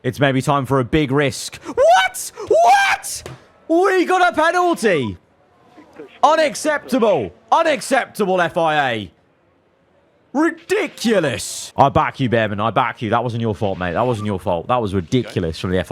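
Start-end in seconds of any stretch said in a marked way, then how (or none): 1.6-5.2 screaming in shock at the penalty; outraged shout. Real, not acted. Player learning of the penalty, shrieking in outrage. 6.2-11.7 indignant. Real, not acted. Protesting the penalty.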